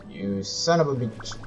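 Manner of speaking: ordinary talk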